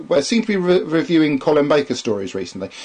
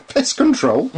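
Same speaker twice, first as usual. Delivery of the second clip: amused surprise; 10 semitones higher, slower than usual. Real, not acted.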